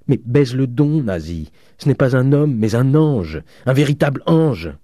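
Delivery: high, loud, exalted cry; frenzied fervour. A narrator's reading. Father praising Eugène to his daughter, exalted. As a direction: exclaim it in loud adoration. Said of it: "avec une sorte de fureur".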